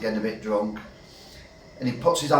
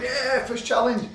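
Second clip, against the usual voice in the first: higher, slow mock cheer from the crowd; pub cheering; 11 semitones higher, slower than usual. Whole pub cheers the knockout, high mock cheer.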